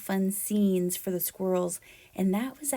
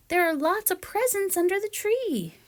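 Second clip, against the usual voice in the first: bright storyteller; 11 semitones higher than usual. A narrator's reading. Storyteller lifts voice.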